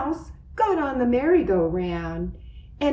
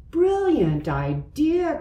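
higher, delighted cry; excited; 7 semitones higher than usual. A narrator's reading. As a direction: cry it out with glee. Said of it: "cried Elephant".

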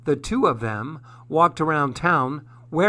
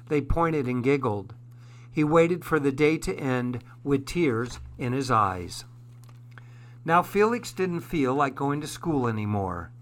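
ordinary delivery

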